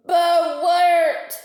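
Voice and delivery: high, loud gummy bark of an order; toothless command. A narrator's reading. Toothless shark commands the laughing narwhals, loud gummy order. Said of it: "speaking without teeth make words sound different".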